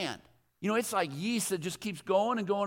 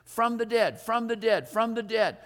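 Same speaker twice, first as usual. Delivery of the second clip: FROM THE DEAD fired off three times, firm and falling; insistent repeated punch on "from the dead"; continual emphasis; 2 semitones higher, 3 dB louder than usual. Shown as a demonstration. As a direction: drum in "from the dead". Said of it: "the continual emphasis".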